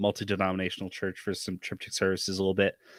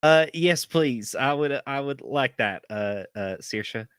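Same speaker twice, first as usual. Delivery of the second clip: put-on bashful farm boy; 5 semitones higher, 5 dB louder than usual. Gus bashful, leaving.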